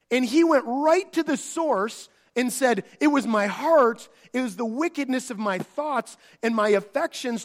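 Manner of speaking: loud, rising, fervent sermon delivery; full-force preaching. Acted. Preacher explaining David's repentance, loud and fervent. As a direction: preach it out to the congregation.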